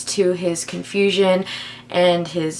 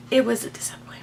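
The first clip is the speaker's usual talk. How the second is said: whispered aside; 7 dB quieter, much faster than usual. Real, not acted. Whispered aside after rating book.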